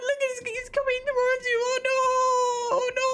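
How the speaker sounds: high, loud hammed-up panic; squealing fake terror at the hissing jar; mock panic. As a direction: shriek a warning as if in a horror film. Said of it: "Vegemite hisses".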